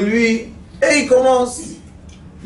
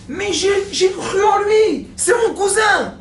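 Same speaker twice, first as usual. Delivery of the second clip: whiny complainer; 12 semitones higher, slower than usual. Acted. Complainer defends himself.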